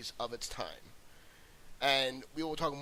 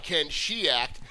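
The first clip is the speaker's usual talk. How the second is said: usual speaking voice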